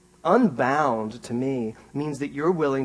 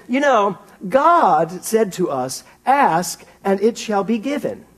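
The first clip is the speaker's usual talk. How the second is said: quoting Edwene; 8 semitones higher, 6 dB louder, slower than usual. Retold or imitated. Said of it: "She said".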